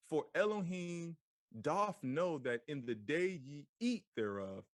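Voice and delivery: put-on slow, measured reading of old Bible words; solemn scripture reading. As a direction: read it like holy scripture, unhurried. Reads scripture slowly aloud.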